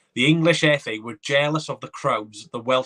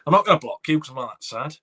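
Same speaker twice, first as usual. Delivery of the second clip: normal talking voice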